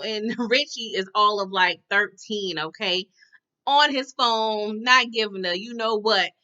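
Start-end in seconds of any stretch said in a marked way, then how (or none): none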